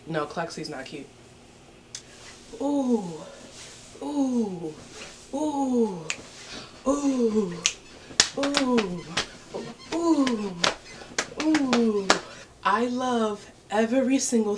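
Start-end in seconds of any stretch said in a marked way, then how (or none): 2.6-12.1 repeated delighted coos; cooing ooh. Real, not acted. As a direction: coo over the dress. Admiring the dress.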